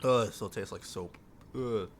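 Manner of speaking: ordinary talk, nothing marked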